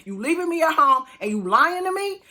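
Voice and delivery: high, loud indignant yell; mock furious wife; outraged wife. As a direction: hurl the accusation up high. Voicing angry pregnant wife.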